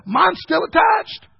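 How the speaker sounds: high, slow, shouted comeback; shouted punchline. Retold or imitated. Preacher shouting his comeback at a man dodging the tithe.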